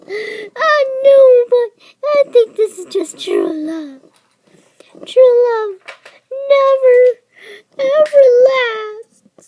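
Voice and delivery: high, loud sobbing lament; melodramatic crying. Acted. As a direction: wail over lost true love. Melodramatic love speech.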